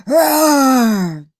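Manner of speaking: huge loud monster roar; earth-shaking roar. Acted. Dad reacts to unicorn son, roaring. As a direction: let it shake the mountain. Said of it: "Dad let out an earth-shaking roar".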